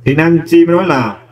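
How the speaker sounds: ordinary talk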